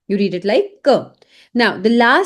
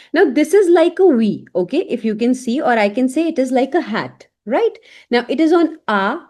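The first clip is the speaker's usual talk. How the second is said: normal talking voice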